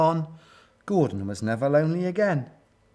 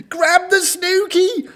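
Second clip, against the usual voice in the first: high, loud, drawn-out gushing cry; excited rooting yell, slowly stretched; gushed shout; 8 dB louder than usual. A narrator's reading. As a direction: squeal it eagerly. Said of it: "gushed the gorillas".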